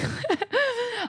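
a sudden high laugh at herself; high burst of laughter. Real, not acted. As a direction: laugh it off at your own expense. Laughing at her own annoyance.

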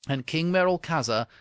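usual speaking voice

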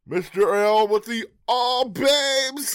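high, loud, slow announcer call; catchphrase voice. Pretend. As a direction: belt out the catchphrase, drawn out. Calling out a catchphrase.

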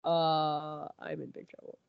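retold Han dreading what comes next, quietly; dread mutter. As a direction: let it sink in like a mumbled realisation. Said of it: "Han's standing there going".

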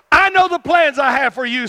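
loud, high prophetic declaration; proclaiming as God. Acted. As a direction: thunder the promise out to the people. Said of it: "go into people and see people and say".

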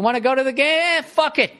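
high-pitched, tossed-off decision; acted casual impulse. Acts out spontaneous decision.

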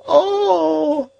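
put-on high, wailing old woman's cry; despairing cry. A narrator's reading. Old woman collapsing at his feet, despairing high cry. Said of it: "she cried despairingly".

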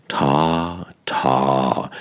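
both syllables "Ta, ta" hit equally hard; two stressed beats. Shown as a demonstration. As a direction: tap out "Ta, ta" with even weight. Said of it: "zwei tragenden Silben".

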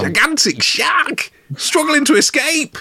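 very high, drawn-out mock thrill; hammed-up, high-pitched excitement; feigned amazement. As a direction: squeal it out slowly like a spectacle. Hero feigns seeing a shark fight.

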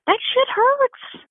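high, slow, stretched out in disbelief; emphatic complaint. Retold or imitated. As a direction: drag it out like it still hurts. Painful memory, no one warned.

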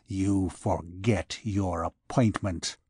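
slow, low bitterness; bitter. A narrator's reading. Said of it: "his tone vibrated with bitterness".

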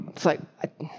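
ordinary delivery

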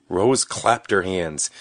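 plain reading voice

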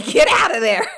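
high, loud laughing yell; retold shout. Mom to son becoming one with the bear, shouting. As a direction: yell it in mock horror, half laughing.

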